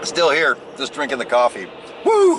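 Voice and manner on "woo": high loud whoop; excited woo. Real, not acted. On his coffee break, a loud high whoop. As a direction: whoop with sudden energy.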